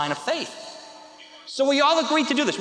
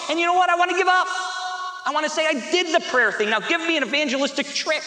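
acted exasperation; 6 semitones higher than usual. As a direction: play someone fed up and ready to quit. Voicing someone ready to give up.

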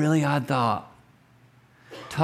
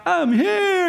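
put-on much higher, bright kid voice announcing himself; carefree little kid. Preacher imitating a carefree five-year-old walking out.

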